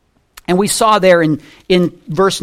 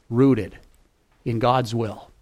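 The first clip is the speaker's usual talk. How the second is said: usual speaking voice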